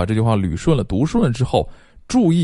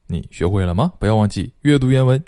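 ordinary delivery

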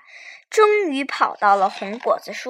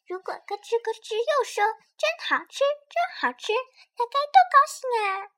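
small dino voice, much higher; high child voice; 12 semitones higher, 4 dB quieter, faster than usual. Acted. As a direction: squeak it out like a little kid. Little dinosaur imagining happily.

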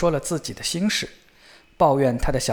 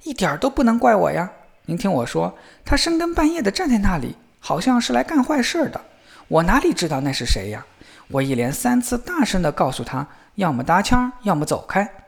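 higher, pleading justification; protesting self-defence; 6 semitones higher than usual. A narrator's reading. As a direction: insist to father that it wasn't your fault. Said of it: "小伙子申辩说".

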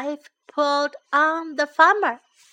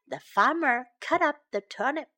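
usual speaking voice

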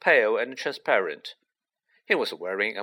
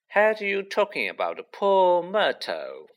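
high, slow, sing-out taunt; 8 semitones higher than usual. A narrator's reading. Poltergeist teases about gossip he overheard, taunting.

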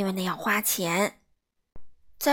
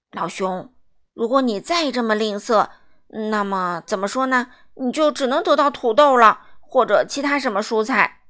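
louder, firm stern wizard voice; stern warning; 6 dB louder than usual. Acted. Wizard warning the miser, stern and loud. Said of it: "他又严厉地补充说".

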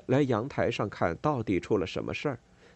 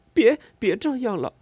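pleading; 12 semitones higher, 6 dB louder than usual. A narrator's reading. Boy begging mother to stop.

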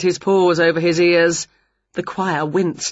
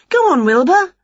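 high, loud prompt to a cat; encouraging witch; 11 semitones higher, 5 dB louder than usual. A narrator's reading. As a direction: nudge the cat on, pitched high. Winnie prompting her cat.

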